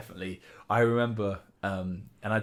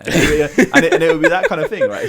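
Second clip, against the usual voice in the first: laughing loudly through words; loud laugh while talking; 10 semitones higher, 14 dB louder than usual. Real, not acted. Laughing after punchline.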